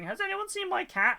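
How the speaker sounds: high, pleading lost-pet plea; mock-worried owner asking around; worried cat owner. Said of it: "a poster up going".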